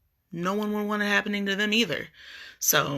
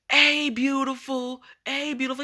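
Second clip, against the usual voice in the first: catcaller hollering; 9 semitones higher than usual. Retold or imitated. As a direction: sing it out like a guy on the street. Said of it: "this dude is hollering at me".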